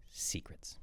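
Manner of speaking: whispered for weight; confiding, voiceless whisper; hushed reveal. Real, not acted. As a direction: drop to a whisper on the key word. Hushed reveal of the key finding.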